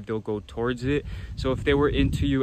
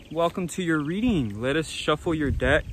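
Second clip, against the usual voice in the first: ordinary talk, nothing marked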